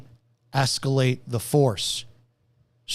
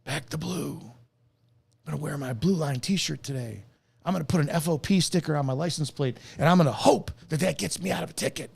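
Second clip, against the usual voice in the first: much softer, put-on sneering voice; mocking cop supporters; 3 dB quieter than usual. Mocking blue-line fans bragging to viewers, soft sneer. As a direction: sneer it as a smug cop fan. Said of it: "And then you stupid idiots".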